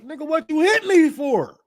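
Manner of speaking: high, indignant mock reply; a touch of high mock scorn; mock indignant shooter. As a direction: toss the question back with high scorn.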